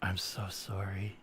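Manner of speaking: low, slow, very soft radio-host voice; NPR host voice. Pretend. Apologising for creeping a friend out, hushed NPR host. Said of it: "Now you sound like an N P R host".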